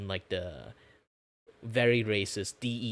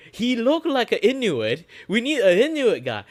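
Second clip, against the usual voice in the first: mocking casting director; 13 semitones higher, 13 dB louder than usual. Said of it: "Instead of, like".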